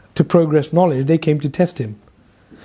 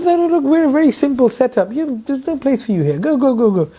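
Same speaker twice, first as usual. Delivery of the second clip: dismissive master; 8 semitones higher than usual. Acted. Murshid sends Ghazali away.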